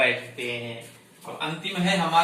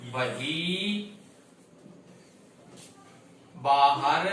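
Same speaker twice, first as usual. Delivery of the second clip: slow dictation; much slower than usual. Shown as a demonstration. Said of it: "या इसको हम यहां भर देंगे".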